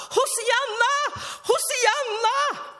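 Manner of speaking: high, long drawn-out shouts from the ice; panicked calls for rescue, stretched out slow; desperate cry for help. Acted. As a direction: yell Hosianna like someone who has fallen through the ice. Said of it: "Då kan man ropa".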